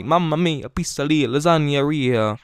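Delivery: mock Italian accent, stretching the last word slowly. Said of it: "that's my Italian accent".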